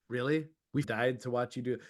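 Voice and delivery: soft, low unimpressed ghost; mock ghostly disbelief. Bored ghosts judging the living, soft low mock disbelief. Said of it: "they're like".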